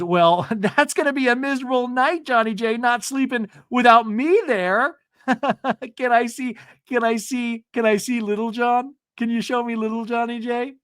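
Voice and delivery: high-pitched giggly flirting.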